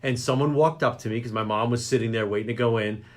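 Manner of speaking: ordinary delivery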